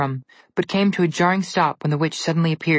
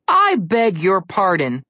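offended; 3 semitones higher, 4 dB louder, much slower than usual. Acted. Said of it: "Connor was so offended".

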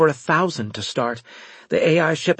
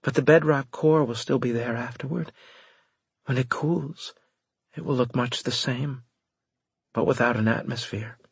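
subdued character voice, slightly raised; deadpan and cool; 3 semitones higher than usual. A narrator's reading. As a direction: report the aftermath without feeling, hope gone. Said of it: "Her voice was deadpan and cool".

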